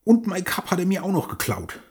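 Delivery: a huffy, put-upon grumble; grumbling. Acted. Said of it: "erbost dazu und brummelte".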